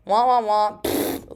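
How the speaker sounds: loud comic wah-wahs, then a raspberry; goofy wah-wah and blown lips; mock wailing. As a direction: make silly noises to puncture the seriousness. Mocks her own seriousness with noises.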